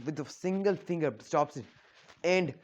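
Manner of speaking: ordinary talk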